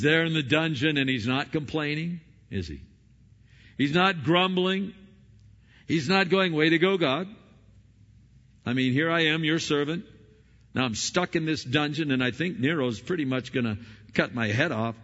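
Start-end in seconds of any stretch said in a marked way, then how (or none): 3.8-6.5 not punched high and loud; stress on not. Acted. Preacher denying Paul complained, not hit high and loud. 6.5-15.0 hammed-up thanks-a-lot sarcasm; sarcastic complaint. Paul imagined sarcastically griping at God from the dungeon. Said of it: "that kind of attitude".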